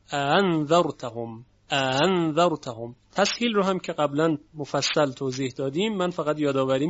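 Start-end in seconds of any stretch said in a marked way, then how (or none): 0.1-3.0 اَأَنذَرْتَهُمْ with the second hamza eased into a glide; softened second hamza. Shown as a demonstration. Teacher shows Warsh's reading, second hamza softened. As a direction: recite اَأَنذَرْتَهُمْ, no hard stop on the second hamza. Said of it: "به تسهیل همزهٔ دوم ورش خوانده".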